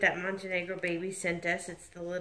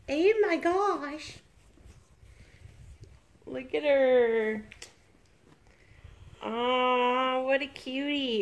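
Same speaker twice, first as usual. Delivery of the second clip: cooing; 7 semitones higher, 3 dB louder, much slower than usual. Real, not acted. Cooing over the dressed baby.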